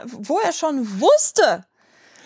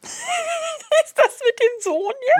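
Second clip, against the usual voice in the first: laughing; much slower than usual. Real, not acted. Cohost laughing at story setup.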